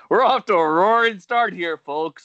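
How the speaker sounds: high, loud announcement breaking into laughter; helpless laughter through a show-host line; laughing. Real, not acted. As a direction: crack up while addressing the audience. Hosts crack up at botched bit.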